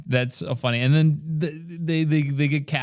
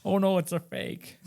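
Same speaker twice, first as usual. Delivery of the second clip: higher put-on voice of a fussy father; hammed-up old professor; 8 semitones higher than usual. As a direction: play the dismayed old scholar, pitched up. Retelling Henry Jones in the scene.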